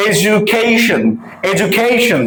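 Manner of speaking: এডুকেশন with a j sound, "e-ju-KAY-shun"; correct English pronunciation. Shown as a demonstration. Teacher reads out the transcript, correct careful pronunciation. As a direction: model এডুকেশন carefully, d turned to j. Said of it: "উচ্চারণটা হচ্ছে".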